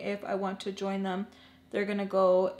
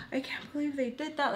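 higher-pitched mock upset; imitated tearful complaint; 6 semitones higher, 3 dB quieter than usual. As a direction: lightly mimic a tearful phone call. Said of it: "calling and crying and telling her".

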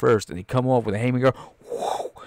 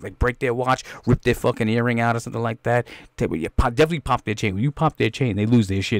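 ordinary talk, nothing marked

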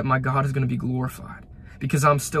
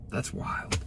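whispered awe; 9 dB quieter than usual. Real, not acted. Awed aside at story's end.

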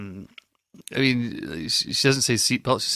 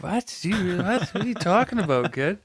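baffled; 8 semitones higher than usual. Retold or imitated. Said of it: "you, like".

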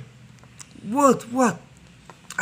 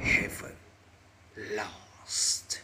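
villain voice; 12 semitones lower, 7 dB quieter than usual. Pretend. Defeated villain vows revenge.

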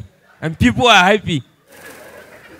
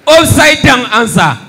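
slow, loud laughing yell, higher; laughing shout; 4 semitones higher, 8 dB louder than usual. Acted. Comic outburst at crowd.

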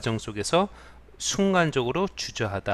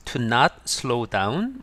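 slow reading; 3 dB louder, much slower than usual. Shown as a demonstration. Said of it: "teacher reads English definition".

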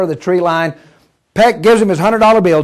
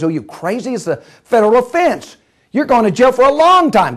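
high, indignant threat; angry scolding; 6 semitones higher than usual. Acted. Shaken engineer to man on the track, indignant scolding. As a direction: lay into him, pitch climbing.

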